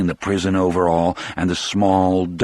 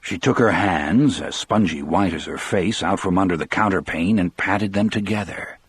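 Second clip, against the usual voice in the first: plain reading voice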